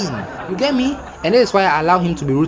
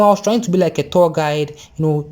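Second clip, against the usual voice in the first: ordinary delivery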